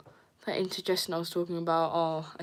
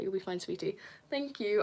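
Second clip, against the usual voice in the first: normal talking voice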